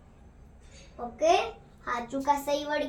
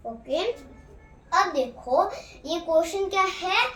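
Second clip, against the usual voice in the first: usual speaking voice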